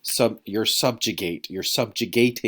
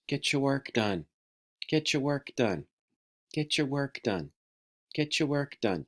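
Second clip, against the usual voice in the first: higher-pitched, identical chant with short pauses; nagging repetition; 4 semitones higher, 4 dB quieter than usual. Shown as a demonstration. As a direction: drill it into them, same tune every time. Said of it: "constantly say the same thing over and over".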